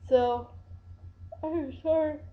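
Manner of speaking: yawning while talking; words stretched by a yawn. Real, not acted. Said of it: "I'm sorry I'm yawning so much".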